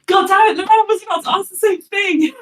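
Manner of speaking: high, loud frustrated exclaim; exasperated outburst. Acted. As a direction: throw up your hands, loudly.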